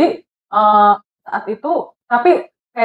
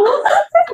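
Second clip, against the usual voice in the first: high squealing laugh at herself; sudden high laugh; high burst of laughter. Real, not acted. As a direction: crack up at her own nerve. Laughs at own cheekiness.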